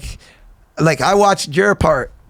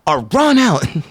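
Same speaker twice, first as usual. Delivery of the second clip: mock excited friend; 8 semitones higher than usual. Friend's excited declaration retold.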